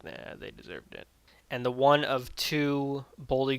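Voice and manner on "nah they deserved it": very low, hushed imitation of classmates; mock-callous aside, much softer and deeper; dismissive murmur. Whole class shrugs off the deaths.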